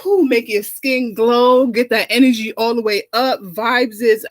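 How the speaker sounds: a diva's hammed-up sponsor plug; campy talk-show ad read; sassy ad read. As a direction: sell the juice like a glamorous host. Sponsor plug for fruit juice.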